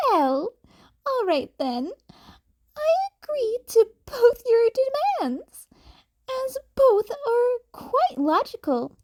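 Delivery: high, chirpy small-animal voice; a bright little principal; Nezu's voice. A narrator's reading. Nezu voiced.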